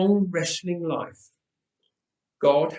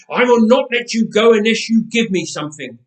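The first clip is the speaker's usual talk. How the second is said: louder, higher strained demand; struggling for breath; 5 semitones higher, 6 dB louder than usual. A narrator's reading. Jacob, breathless, refuses to release his opponent, straining loud. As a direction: force it out while fighting for air. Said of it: "struggling for breath".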